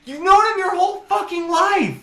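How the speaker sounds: very high, exasperated disbelief; mock outrage. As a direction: rant in comic indignation. Mock outrage at movie plot.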